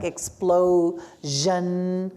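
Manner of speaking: explosion drawn out, leaning on the zh; slow zh demo. Shown as a demonstration. Teacher to class: slow model of explosion. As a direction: say "explosion" very slowly, stretching the zh. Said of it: "S I O N".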